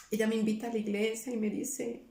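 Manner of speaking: ordinary talk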